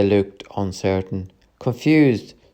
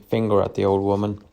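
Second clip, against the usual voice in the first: usual speaking voice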